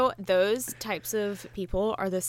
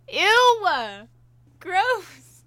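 high loud grossed-out cry; disgusted shriek; 11 semitones higher, 7 dB louder than usual. Real, not acted. Reacting to gross habit.

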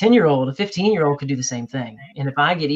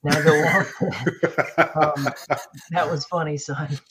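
laughing dad; 5 dB louder than usual. Pretend. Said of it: "So I'd be like".

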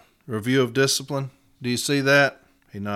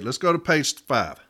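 normal talking voice

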